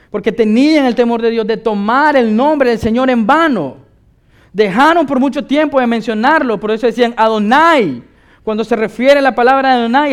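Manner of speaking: loud, high preacher's delivery; impassioned preaching. Acted. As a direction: preach it emphatically at full force.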